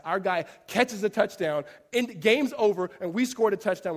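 excited retelling. Retold or imitated. Retelling the thrilling last-second touchdown.

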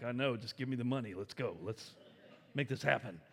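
nervous muttered plea to hurry the cash; hurried hush. Retold or imitated. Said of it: "I'm like".

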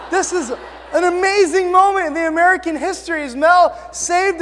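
loud, high, hammed-up patriotic fervour; mock-triumphant proclaiming. Preacher jokingly crediting Mel Gibson with winning independence, loud mock triumph. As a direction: proclaim it like a rousing victory speech.

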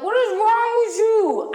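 high, loud retold snap; snapped. Girl snapping at her date at the photo booth, loud. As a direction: snap at him in front of everyone. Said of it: "I snapped in front of".

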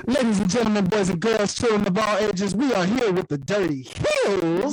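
loud circus ringmaster intro. Acted. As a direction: boom it out to the crowd. Hype intro of guests.

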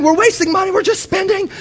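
high mock nagging wife; wife's complaint. Husband mimicking wife complaining about spending, high. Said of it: "this whole thing".

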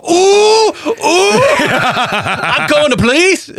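high, slow whiny mock panic; mock tattletale. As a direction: squeal it like a tattling kid, stretched out. Mocking the neighbor as tattletale.

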